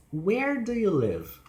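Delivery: high stressed "Where", falling to "live"; emphasize "Where", then slide down; falling question. Shown as a demonstration. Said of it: "falling pitch".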